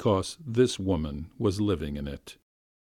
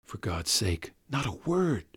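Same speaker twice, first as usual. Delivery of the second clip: higher, then dropping to a hush; shamed gentleman; 4 semitones higher than usual. Acted. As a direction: confess then beg secrecy. Nikolay after confessing.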